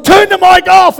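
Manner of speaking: put-on shouting to the kids to kill the mic; panicked shout. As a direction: yell it while rushing to the TV. Panicked order during the retold row.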